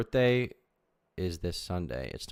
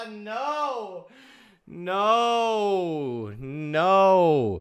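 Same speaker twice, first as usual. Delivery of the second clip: high cries of no, louder each time; repeated horrified no; 10 semitones higher, 5 dB louder, much slower than usual. Real, not acted. Reacting to her message with building cries of no. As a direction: keep refusing it, building up.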